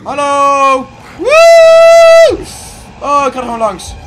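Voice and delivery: very loud, high yell; hollering in fright and bravado; shout. Real, not acted. Yells at the monster.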